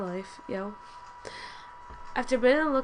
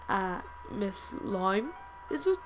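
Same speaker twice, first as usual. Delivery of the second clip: disdainful professor. Acted. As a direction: draw out the scorn as a stern teacher. Voices the disapproving professor.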